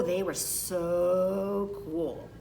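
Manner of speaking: very slow, dreamy admiration; slow, wistful awe at the cool kids; awed. A narrator's reading. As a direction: linger on it like you're starstruck. Narrator in awe of cool beans.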